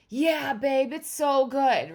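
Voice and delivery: loud, high, drawn-out enthusiasm; hammed-up thrilled agreement; gushing excitement. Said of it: "not going to give me back".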